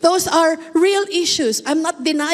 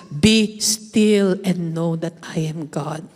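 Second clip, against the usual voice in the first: solemn slow reading; 9 semitones lower, slower than usual. Acted.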